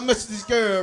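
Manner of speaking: normal talking voice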